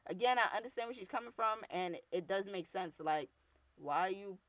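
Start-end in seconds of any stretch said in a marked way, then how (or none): none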